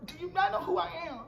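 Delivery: quick, squeaky do you know who I am; shrill mock outrage. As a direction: fire it off like an offended celebrity. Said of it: "I was like".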